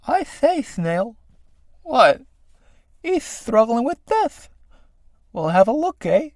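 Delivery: high, silly snail voices; snail characters. Acted. Two snails spotting a man struggling, high piping voices. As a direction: pipe it high and leisurely.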